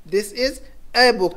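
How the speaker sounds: very slow, with "a" set apart; stress on "a". Shown as a demonstration. Teacher introducing the indefinite article, very slowly stressing "a". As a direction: isolate the article "a" before book. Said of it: "तो ए जो कह रहे हैं ना".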